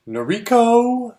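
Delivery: slow, high announcer call of the name. Retold or imitated. Announcer calling the drawn name.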